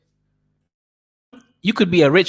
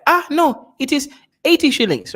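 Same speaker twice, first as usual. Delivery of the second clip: higher, surprised vendor's refusal; vendor refusing; 12 semitones higher than usual. Retold or imitated. Vendor rejects the buyer's haggling, higher mock surprise. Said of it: "Then they'll be like".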